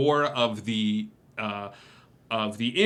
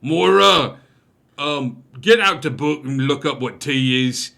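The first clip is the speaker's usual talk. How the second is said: loud call to the back; barkeep yelling; 2 semitones higher, 8 dB louder than usual. Acted. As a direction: yell it toward the kitchen. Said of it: "he's like".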